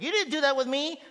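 high, loud whine; whiny older kid. Retold or imitated. Older kids whining at dad, high and loud. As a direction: whine like a jealous teenager. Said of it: "they're always like".

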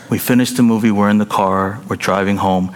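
usual speaking voice

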